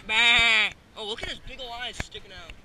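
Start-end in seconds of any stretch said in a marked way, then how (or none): 0.1-0.7 loud bleating goat cry. Pretend. Bleating loudly back at the goat. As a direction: blast out a goat's bah. Imitating goat while playing.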